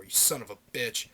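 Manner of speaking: ordinary talk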